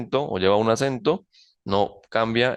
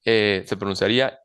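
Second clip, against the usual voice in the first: ordinary delivery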